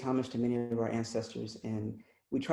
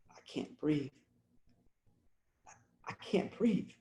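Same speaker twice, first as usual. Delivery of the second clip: poem recital. Acted. Poet voicing Eric Garner's words, fading repetition. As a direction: let each repeat grow fainter.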